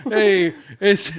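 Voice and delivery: put-on Brooklyn accent. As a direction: start a Brooklyn bit through laughter. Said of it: "no one sounds like that in Brooklyn".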